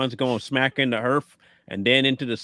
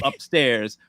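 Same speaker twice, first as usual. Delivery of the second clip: ordinary talk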